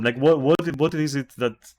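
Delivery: ordinary delivery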